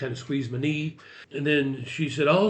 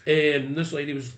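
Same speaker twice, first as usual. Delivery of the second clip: ordinary talk, nothing marked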